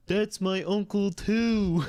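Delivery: put-on childish quote. Retelling Gabe chiming in across the table, high slow kid voice. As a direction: blurt it like a proud little kid, slowly.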